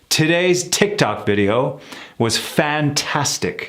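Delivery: slow, overarticulated sentence, every consonant crisp; exaggerated practice sentence. Shown as a demonstration. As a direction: drill it slowly and precisely. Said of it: "words I'm sort of creating off the top of my head".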